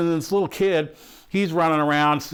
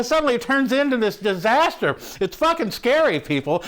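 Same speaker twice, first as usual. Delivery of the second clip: normal talking voice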